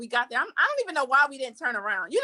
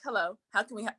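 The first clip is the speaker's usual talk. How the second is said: sour greeting; 4 semitones lower, 6 dB quieter than usual. Retold or imitated. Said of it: "They're like".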